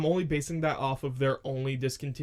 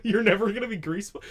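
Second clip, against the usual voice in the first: higher, louder, laughing question; laughing echo; 5 semitones higher, 5 dB louder, faster than usual. Real, not acted. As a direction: echo back in mock disbelief. Echoing friend's refusal laughing.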